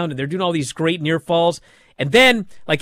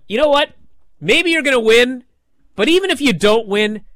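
higher and louder rousing encouragement; hammed-up rallying cheer; mock pep talk; 8 semitones higher, 5 dB louder than usual. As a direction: pump him up like a cheering family. Families encouraging Tony.

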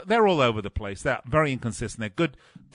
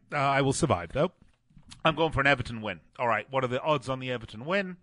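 ordinary talk, nothing marked

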